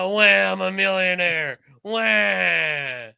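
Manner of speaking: exaggerated wailing crybaby; mock whining. Host mocking pampered rock stars, mock whining. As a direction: whine it out with long, drawn-out wahs. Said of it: "it was turning into".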